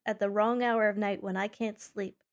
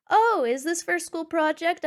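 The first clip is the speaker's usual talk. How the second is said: louder, higher imitation of dad; dad's keen question; 6 semitones higher, 6 dB louder than usual. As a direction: play a curious dad, piping up. Voices dad's question in retold story.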